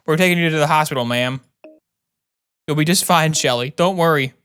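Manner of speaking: ordinary delivery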